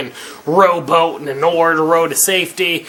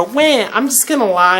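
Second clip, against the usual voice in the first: mock woman's voice; 6 semitones higher than usual. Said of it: "They'll tell you a lie".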